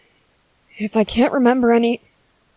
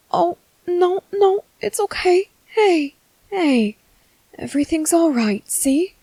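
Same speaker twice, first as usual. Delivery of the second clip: higher, flustered hushing; flustered soothing; 8 semitones higher, slower than usual. Acted. Father flustered as his baby starts crying, higher.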